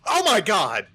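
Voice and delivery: loud, high cry of alarm; startled "oh my god" yell; shocked outcry. Real, not acted. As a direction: exclaim as it blows up on you. Gets blown up in game.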